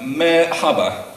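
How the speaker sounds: slow, deliberate "merhaba", spelled out syllable by syllable as mer-ha-ba; careful Turkish hello. Shown as a demonstration. Best man reading a friend's greeting to the bride, slow careful "merhaba". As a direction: say "merhaba" slowly, like a non-native trying hard. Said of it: "excuse my Turkish".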